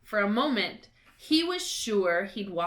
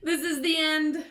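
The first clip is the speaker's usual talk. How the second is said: despairing moan; 7 semitones higher, 3 dB louder than usual. A narrator's reading. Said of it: "Ron moaned".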